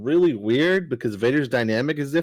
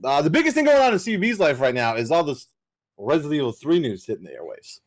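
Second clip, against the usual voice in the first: normal talking voice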